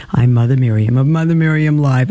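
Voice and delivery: her own Brooklyn accent, plain and friendly. Real, not acted. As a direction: speak with a native Brooklyn accent. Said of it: "I think you recognize my Brooklyn voice".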